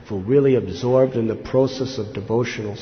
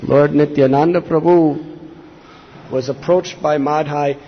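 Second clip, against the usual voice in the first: usual speaking voice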